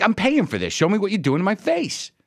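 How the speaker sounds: high-pitched, insistent demand; acted-out exasperated protest; indignant. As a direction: re-enact an indignant patient insisting on his rights. Retold argument with the tech.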